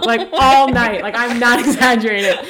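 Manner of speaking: usual speaking voice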